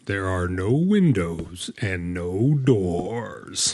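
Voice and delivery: slow, eerie ghost-host delivery; lightly imitated haunted ride host; ride narrator voice. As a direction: drag each word out like the spooky ride narrator. Quoting the stretching room narrator.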